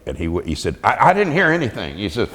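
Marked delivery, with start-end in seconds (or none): 0.8-2.0 dismissive coach. Retold or imitated. Coach brushing off player.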